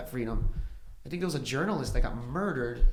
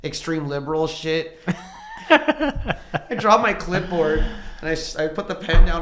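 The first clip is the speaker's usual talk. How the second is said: usual speaking voice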